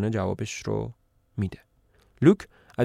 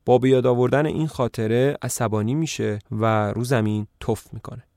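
ordinary delivery, nothing marked

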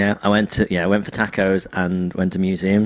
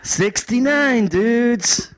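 very high, drawn-out dude catchphrase; Bill and Ted; much slower than usual. Retold or imitated. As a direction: imitate a movie dude, stretching both words. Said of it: "And, and he goes".

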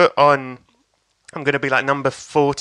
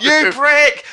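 high, loud imitated jeer; hammed-up heckler shout; audience heckle; 11 semitones higher, 6 dB louder than usual. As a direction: yell it from the crowd. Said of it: "down from the audience".

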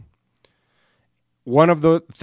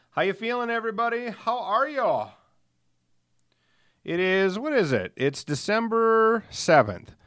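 upbeat greeting; 9 semitones higher than usual. Acted. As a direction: greet the audience cheerfully. Greeting listeners, high voice.

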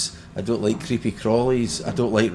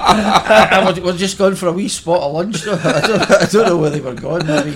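laughing; 7 semitones higher, 7 dB louder than usual. Real, not acted. Quoting [name] while still laughing.

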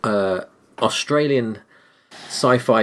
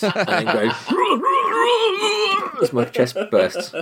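hammed-up dying scream with a laugh; laugh-screaming like a chest bursting open; mock chest-burster scream. Friend acting out the chest-burster scene, mock scream. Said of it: "rehearsing by eating loads of food, like John Hurt".

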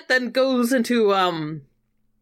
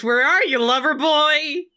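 higher-pitched call, stretched out at the end; mock shout; 7 semitones higher than usual. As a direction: call out teasingly for a missing friend. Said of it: "Mercutio shouts".

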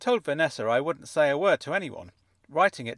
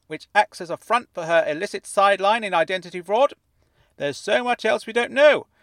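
high, wobbly voice getting more excited; wobbly quivering jelly voice; 9 semitones higher, 6 dB louder than usual. A narrator's reading. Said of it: "wobbly voice grew stranger and wobblier the more excited he became".

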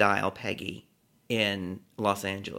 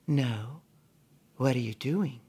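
[name]; 4 dB quieter than usual. Retold or imitated. Singer asks her caller suggestively, soft and languid. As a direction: ask it softly, as if something more intimate. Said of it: "The way she said it".

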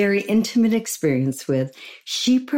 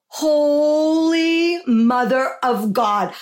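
amazed exclamation; 7 semitones higher, 4 dB louder, slower than usual. Retold or imitated. Host recalls seeing cover photo.

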